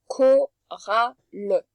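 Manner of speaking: chorale stretched extremely slowly, each sound held. Shown as a demonstration. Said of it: "Et chorale". (chorale, très très lentement, son par son)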